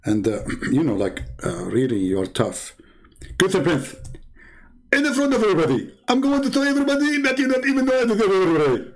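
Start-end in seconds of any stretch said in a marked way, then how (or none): 3.4-8.9 very high, fast cartoon rant; Zakir Naik. Fake Zakir Naik threatens to expose the host, high cartoon rant. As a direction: rattle off a mock threat way up high. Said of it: "Somebody is just joking with you".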